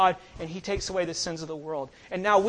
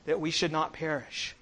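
ordinary talk

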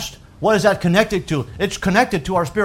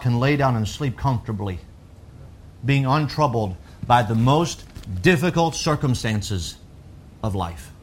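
quiet and calm; 7 semitones lower, 7 dB quieter than usual. Acted. Sermon point on sleep.

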